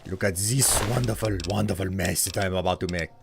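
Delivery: put-on a light, steady French accent while chatting. Streamer doing a redeemed accent for chat, lightly French. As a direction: keep a mild French accent going. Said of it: "I was told to do an accent".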